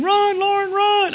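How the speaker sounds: high, cheering yell to his runner; retold scream. Father at the race cheering his daughter, yelling. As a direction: shout her on to the finish. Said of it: "I would scream".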